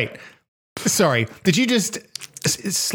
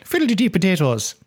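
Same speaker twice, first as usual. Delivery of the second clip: higher-pitched cod-Irish lilt; mock Irish accent; 6 semitones higher than usual. Co-host pretending to quote an Irish singer, mock Irish accent. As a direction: put on a jokey Irish accent. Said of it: "He's Irish, isn't he?".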